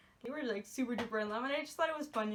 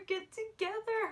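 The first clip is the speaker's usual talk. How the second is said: "get together" much higher, a pleading cry; more desperate urging; 9 semitones higher than usual. Retold or imitated. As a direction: beg them, climbing to the top of your voice. Repeats it, more urgently.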